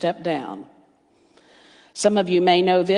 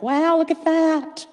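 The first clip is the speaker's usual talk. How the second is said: high, excited sing-song to the birds; talking sweetly to backyard birds; delighted to birds; 5 semitones higher than usual. Retold or imitated. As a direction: coo at a returning bird. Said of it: "and I'm like".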